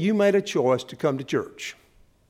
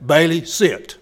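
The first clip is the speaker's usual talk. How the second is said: put-on bossy child command; 7 dB louder, slower than usual. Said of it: "with the authority of a four-year-old".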